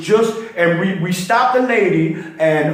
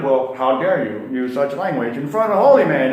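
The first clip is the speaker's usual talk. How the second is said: lower, mock-indignant preacher; outraged pastor; 6 semitones lower than usual. Pastor scolding the butcher, lower and mock-indignant. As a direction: play the scandalized reverend.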